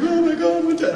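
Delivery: high-pitched mock weeping fan; tearful fans. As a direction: imitate a distraught fan, voice way up. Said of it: "like, in tears and".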